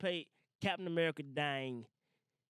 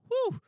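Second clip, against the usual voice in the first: whew; 8 dB louder than usual. Real, not acted. Overwhelmed by the sad episode.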